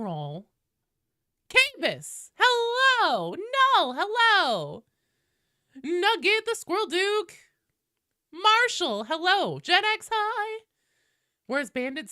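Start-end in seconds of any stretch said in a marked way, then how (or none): 1.5-10.6 sing-song greetings. Real, not acted. Roll call greeting chatters.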